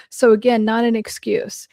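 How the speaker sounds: usual speaking voice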